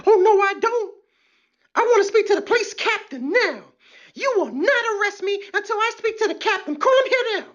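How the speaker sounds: high, mocking arrestee voice; shrill arrestee. Comic mimics an arrestee demanding a lawyer, high mocking voice. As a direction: squeal for a lawyer like a spoiled detainee. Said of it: "When they tell them".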